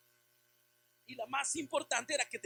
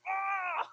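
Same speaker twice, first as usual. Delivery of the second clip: a higher, louder burst of frustration; exasperated scream; 6 semitones higher, 6 dB louder than usual. Retold or imitated. Father reliving one demand too many, exasperated scream. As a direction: cry out, at the end of your rope. Son calls to be picked up.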